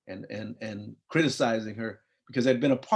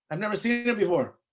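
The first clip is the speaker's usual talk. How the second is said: put-on higher, a puzzled kid protesting; higher-voiced young son talking back; kid's retort; 7 semitones higher than usual. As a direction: pitch it up like a confused boy. Child answering his mother.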